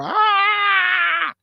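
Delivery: very high, piercing, loud cry "Ah!"; scream of a woman in labour. Retold or imitated. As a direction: scream like a woman giving birth. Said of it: "she's screaming".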